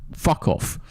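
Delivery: ordinary delivery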